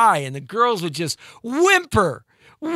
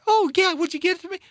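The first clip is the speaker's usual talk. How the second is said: put-on much higher, eager girl's voice; girly reply; 12 semitones higher, faster than usual. The girl at the bar answers the smooth guy, high and eager. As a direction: answer like a thrilled young woman.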